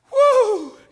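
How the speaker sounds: high, loud pulpit whoop; sudden high holler of delight; excited whoop. Real, not acted. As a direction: let out a loud "woo". Preacher whoops mid-sermon.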